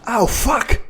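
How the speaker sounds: sharp high yelp of pain, then a curse. Real, not acted. Player caught off guard in the game, yelping in real pain. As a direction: cry out as if suddenly hurt.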